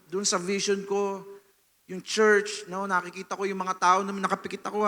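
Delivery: ordinary talk, nothing marked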